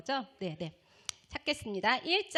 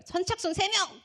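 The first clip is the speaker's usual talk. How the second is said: high announcement to a crowd; pastor calling out; 8 semitones higher, 4 dB louder than usual. Retold or imitated. Pastor limits who comes forward, calling out. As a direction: call it out as the pastor. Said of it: "그러는 거예요".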